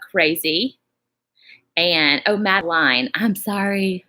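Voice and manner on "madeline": Madeline ending to rhyme with "line"; corrected name pronunciation. Real, not acted.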